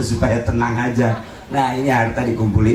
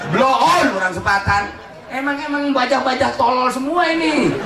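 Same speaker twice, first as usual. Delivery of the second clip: high, forceful pulpit rebuke; scolding preaching; 11 semitones higher than usual. Real, not acted. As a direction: berate the congregation from the pulpit. (ceramah menegur dengan nada tinggi)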